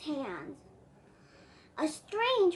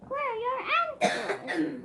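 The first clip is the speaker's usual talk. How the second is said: reindeer voice; 6 semitones higher than usual. Acted. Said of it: "the reindeer asked".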